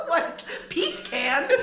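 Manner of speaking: high, loud, laughing question; amused disbelief. Real, not acted. Host joking about the odd pronunciation, laughing incredulously. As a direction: squeal it out through laughter.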